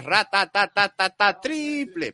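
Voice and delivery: drawn-out very slow commentator chant; Andrés Montes. Retold or imitated. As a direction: stretch ratatatata triple like a famous caller. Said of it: "Como decía Andrés Montes".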